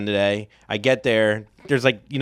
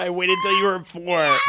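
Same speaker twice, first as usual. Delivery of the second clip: higher dude voice; surfer dad quote; 6 semitones higher than usual. Retold or imitated. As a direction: relish the line. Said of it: "is the best line".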